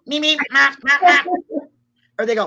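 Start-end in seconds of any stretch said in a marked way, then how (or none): 0.0-1.2 light mock alien syllables, higher up; alien noises. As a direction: babble like a tiny alien again. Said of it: "Is that what they do".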